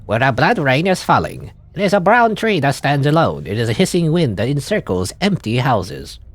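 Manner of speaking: lightly voiced stage poet; poetic recital. Reads a poetic actor's line.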